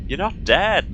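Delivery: high, loud, theatrical disbelief; dramatic exclamation. Acted. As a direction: burst it out like a soap-opera reveal. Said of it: "I don't know why I said that quite so dramatically".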